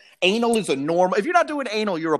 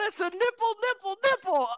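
put-on much higher, squeaking each nipple like a thrilled kid; excited discovery; 14 semitones higher, 3 dB quieter than usual. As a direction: gasp it out like a boy spotting something forbidden. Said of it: "I'd be like".

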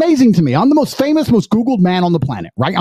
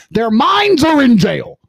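heated rant; 9 semitones higher than usual. Real, not acted.

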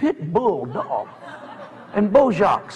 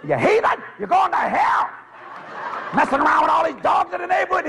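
scolding shout; 7 semitones higher than usual. Retold or imitated. Scolding the dog.

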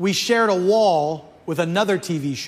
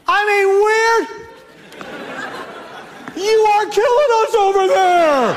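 very loud, very high, slow hammed-up shout; even bigger mock yell; 13 semitones higher, 4 dB louder, slower than usual. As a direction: push the fake rage way over the top. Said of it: "Just like that".

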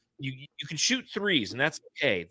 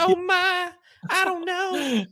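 very high falsetto little lady; high coy falsetto, blonde-curls belle; 3 dB louder, much slower than usual. Pretend. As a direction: squeak it out like a coy southern belle. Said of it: "be like".

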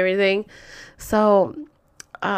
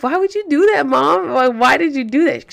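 higher-pitched retold pleading; exasperated protest; 5 semitones higher, 3 dB louder than usual. Daughter questioning mom about the letter, high exasperated protest. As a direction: demand an explanation, pitched up.

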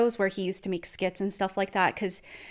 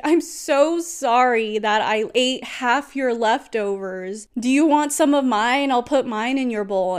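mock apology, drawn out then rising louder; sarcastic; 3 semitones higher, 5 dB louder than usual. Partner offers to share his leftovers, sarcastic. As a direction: imitate a sarcastic, over-sorry partner. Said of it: "He started being very sarcastic".

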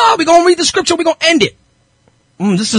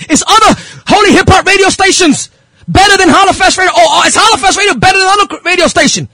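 impassioned preaching; 7 semitones higher than usual. Real, not acted. Preacher confronting rival radio listeners, impassioned. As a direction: drive each question home like a preacher.

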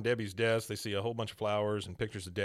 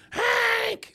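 high, loud scream of the name; 9 dB louder than usual. Retold or imitated. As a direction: shriek it across the yard. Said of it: "She just screaming for him".

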